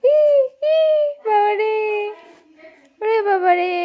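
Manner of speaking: high, drawn-out mock alarm; playful exclamation. Adult playing along with baby, high mock-alarmed exclamation.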